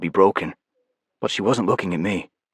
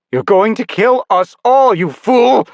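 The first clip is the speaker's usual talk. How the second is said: high, loud, slowed furious yell; furious shout; 13 semitones higher, 7 dB louder, slower than usual. Acted. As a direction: scream at the fool. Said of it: "Wing Flare shouted".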